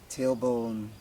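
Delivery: ordinary talk, nothing marked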